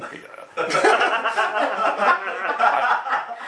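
big high laugh; laughing aloud. Real, not acted. Preacher laughing at his own joke to congregation.

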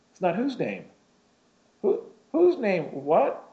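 baffled, pitch jumping up on the second whose; a sudden high double-take at whose; confused surprise. Retold or imitated. Baffled man asking the angel which name it means, surprised.

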